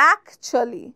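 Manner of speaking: actually clipped to "akchli", middle swallowed, low and soft; actually said native-style, "akchli", dropped quiet; correct pronunciation. Shown as a demonstration. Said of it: "The correct pronunciation is".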